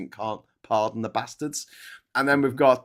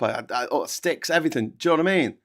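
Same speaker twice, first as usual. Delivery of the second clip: normal talking voice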